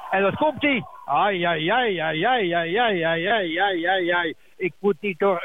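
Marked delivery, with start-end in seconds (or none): 0.1-0.8 excited call. Real, not acted. Ball coming in for scoring chance. 1.1-4.3 long run of ai-ai cries at the chance; excited ai cries. Real, not acted. Long ai-ai after goalmouth chance.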